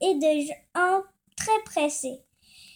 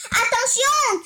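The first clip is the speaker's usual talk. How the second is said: sharp, high, loud warning shout; warning cry; 7 semitones higher, 4 dB louder than usual. Acted. Camel warning Lou about the Nile, a loud high cry. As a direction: yell it out to stop him in time. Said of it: "cria le dromadaire".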